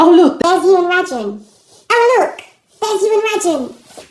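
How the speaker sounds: high, fast mock-excited friend; chirpy put-on voice of the friend. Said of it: "she'll be like".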